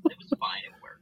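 whispered through a laugh. Real, not acted. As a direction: laugh it out under breath. Repeats line laughing, whispering.

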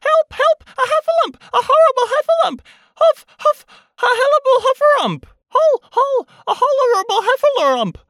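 very high, loud panicked squealing; frantic little pig shrieking as it flees; Piglet crying out. A narrator's reading. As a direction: squeal the alarm, tripping over the words. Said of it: "still crying out".

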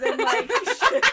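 loud, breaking into laughter; words giving way to real laughter; cracking up. Real, not acted. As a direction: laugh through the punchline. Cracks up at own joke.

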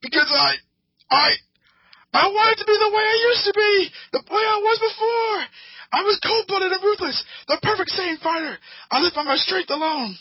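high, voice breaking into a cry; hammed-up tearful confession; anguished Vegeta. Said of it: "And Vegeta's like".

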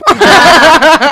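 loud high burst of laughter; real laugh at her own flattery; big amused high laugh. Real, not acted. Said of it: "laughing after confession".

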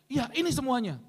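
high acted insistence; insistent wife. Wife insists to apostle it is everything, acted high insistence. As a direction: insist as the wife, pitched well up.

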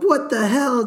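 high, alarmed blurt; mock panicked yelp; panicked swearing. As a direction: swear in startled confusion. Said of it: "Just screaming expletives".

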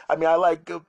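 ordinary talk

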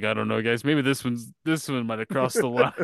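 low, soft hesitant musing; a hint of a doubtful teenager; mock Vecna. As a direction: murmur it low and unsure.

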